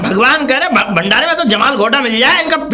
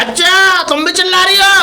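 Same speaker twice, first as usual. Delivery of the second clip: indignant; 6 semitones higher than usual. Acted. Angry visitor accuses her.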